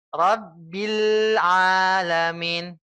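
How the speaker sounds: Rabbil alamin slow with the ain of alamin sounded clearly. Shown as a demonstration. Ustaz showing the pronounced ain, slow chant. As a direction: bring out the ain in alamin, slowly. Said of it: "ainnya dimunculkan".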